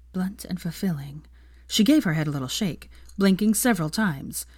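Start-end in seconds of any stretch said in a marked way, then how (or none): 0.1-1.2 hushed, barely voiced; whispered thought. Hermione unable to stop thinking about it, whispered to herself. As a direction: whisper it to yourself, distracted.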